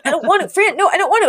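put-on high, laughing whine of a boy who refuses; reluctant kid whine. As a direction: whine that you don't want to go in, half laughing. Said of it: "And he's like".

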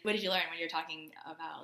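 ordinary delivery

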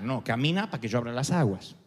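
low, calm correction; a hint of a deep God voice.

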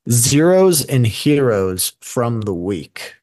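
slow, drawn-out segment title; announcer voice. Shown as a demonstration. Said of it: "announcing the new segment title".

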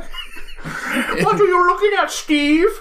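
very high, slow, laughing through it; laughing squeaky voice. Pretend. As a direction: giggle while squeaking the line.

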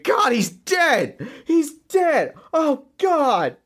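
high, drawn-out mock scream; horrified wrestling commentator's wail. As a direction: scream it in disbelief from ringside, without much added volume. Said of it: "when you hear him screaming".

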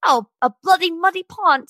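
badly faked British accent for a celebrity quote. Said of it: "Sorry, that was horrible".

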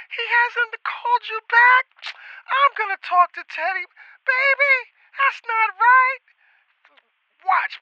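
put-on bubbly Southern accent. Girlfriend's aunt promises to help him, bubbly Southern accent. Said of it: "this southern kinda, like, bubbly kinda".

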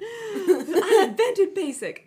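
put-on very high, loud basic girl squeal. Joining the joke about a basic pumpkin latte drinker, squealing high.

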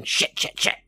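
voiceless, rapid burst of cursing; mock strained yell. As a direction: curse in pretend despair. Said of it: "falls to the ground yelling".

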